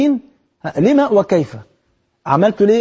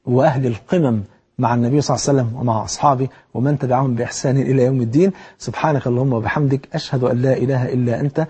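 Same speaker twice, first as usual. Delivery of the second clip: closing prayer; 5 semitones lower than usual. Acted.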